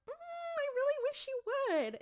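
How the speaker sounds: high, pleading mock coax; flirty coaxing Bobby. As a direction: coax her in a put-on high voice. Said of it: "And he's like".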